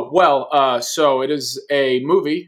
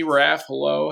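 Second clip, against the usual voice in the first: ordinary talk, nothing marked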